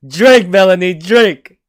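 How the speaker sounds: ordinary talk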